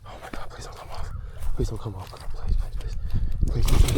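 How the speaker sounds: hushed, half-whispered begging; anxious pleading. Real, not acted. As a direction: plead under your breath for it to hold. Begs hooked fish to stay on.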